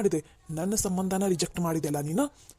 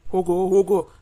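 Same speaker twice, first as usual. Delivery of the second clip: angry shout; 8 dB louder than usual. Acted. Father shouts friend away angrily.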